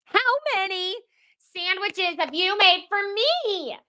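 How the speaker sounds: high, strained mock scream. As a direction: scream it out in pent-up frustration. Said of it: "just scream out".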